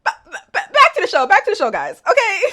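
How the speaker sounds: high-pitched laughter breaking through the words; trying to get back on track while cracking up; laughing. Real, not acted. Telling viewers to get back to the show, still laughing.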